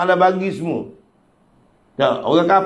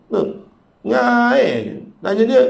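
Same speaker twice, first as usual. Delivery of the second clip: ordinary talk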